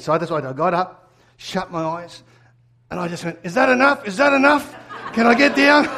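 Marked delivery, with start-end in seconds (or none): 3.4-5.9 nervous laughing plea. Retold or imitated. Pleading on chair in church.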